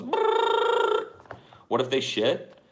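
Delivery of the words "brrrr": high buzzing brrrr; robot noise. Retold or imitated. Tickle robot buzzing at the baby's feet. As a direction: buzz like a little tickle robot. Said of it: "a little robot that comes out and goes".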